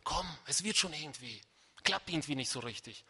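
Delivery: soft, low, resigned consolation; quiet, half-hearted. Acted. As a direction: murmur limp encouragement to a fighter. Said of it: "Er hat das nicht leise gesagt".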